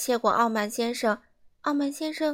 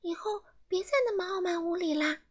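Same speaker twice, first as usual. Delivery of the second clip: elf warning; 8 semitones higher than usual. Acted. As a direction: warn him gently as a tiny elf. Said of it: "小精灵警告傲慢先生说".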